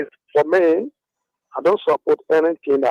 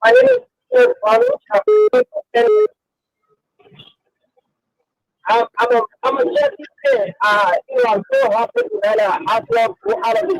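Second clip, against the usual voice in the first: over the phone. Real, not acted. Caller on phone, high voice.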